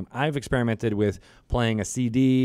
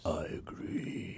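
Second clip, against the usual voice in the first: whisper; 13 dB quieter, much slower than usual. Shown as a demonstration. Mimicking the gravitas take.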